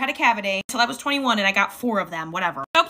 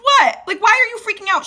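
much higher and louder, near-screaming panic; shrill panicked squeal; 11 semitones higher, 7 dB louder than usual. Retold or imitated. Talking back to the nurse, high panicked squeal. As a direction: shriek it out like you're losing it. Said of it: "I'm like".

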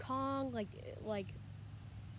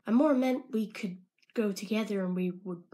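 normal talking voice

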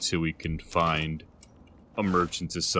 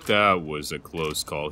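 usual speaking voice

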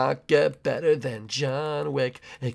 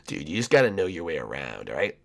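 low bro character voice; 10 semitones lower, 7 dB quieter, faster than usual. Acted. Abstract skin character talking.

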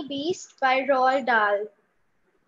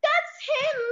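put-on much higher, eager child's cry; excited child; 12 semitones higher than usual. Reciting girl voices the child crying out, much higher. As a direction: point him out excitedly. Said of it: "voice modulation from mummy to child".

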